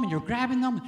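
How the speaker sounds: ordinary talk, nothing marked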